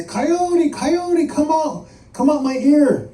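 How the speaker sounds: high, pleading monster wail; begging call. Acted. Monster begging Coyote out of his body, high pleading call. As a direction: call out high and beg them to leave. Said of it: "began to beg Coyote to come out".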